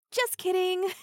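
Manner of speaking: spring the trick on him loudly; gleeful mocking gotcha; loud teasing gotcha.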